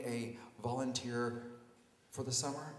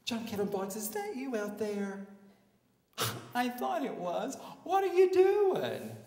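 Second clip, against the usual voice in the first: high put-on girly voice, rising to a squeal; sorority girl; 9 semitones higher, 4 dB louder than usual. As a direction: greet an acquaintance with gushing surprise. Sorority girl greets him.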